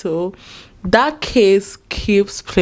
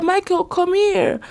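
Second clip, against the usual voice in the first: much higher, bossy mock wife voice; high mocking impression of a wife summoning her husband; imitating Dalia; 11 semitones higher than usual. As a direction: mimic her ordering her husband over, pitched way up. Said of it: "She's like".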